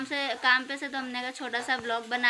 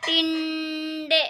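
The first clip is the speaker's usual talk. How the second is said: teasing; 3 semitones higher, 6 dB louder, much slower than usual. Real, not acted. Wife teasing husband about dinner.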